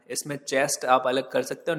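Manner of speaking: ordinary delivery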